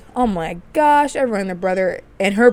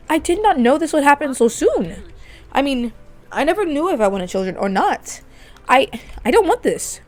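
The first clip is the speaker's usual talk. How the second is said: higher, anxious pleading; husband telling his wife he isn't ready, higher and strained; upset husband; 6 semitones higher than usual. Acted. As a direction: protest with a nervous edge. Chase voiced refusing children.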